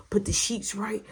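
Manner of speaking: usual speaking voice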